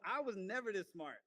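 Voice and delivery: ordinary talk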